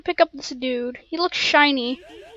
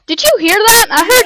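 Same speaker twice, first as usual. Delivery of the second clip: excited; 6 semitones higher, 5 dB louder than usual. Real, not acted. Hears echoing yeets.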